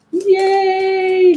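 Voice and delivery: high, long-held yay; playful high-pitched celebration; high cheer. Real, not acted. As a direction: let out a drawn-out cheer. Cheering after feeling great.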